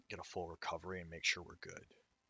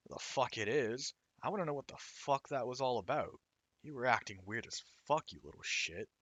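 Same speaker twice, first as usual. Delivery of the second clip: angry rough voice; 5 semitones higher than usual. Acted. Angry character confronts Sakuya.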